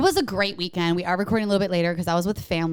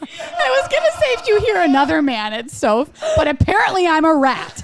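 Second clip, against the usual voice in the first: laughing; 8 semitones higher, 6 dB louder than usual. Real, not acted. Laughing while teasing.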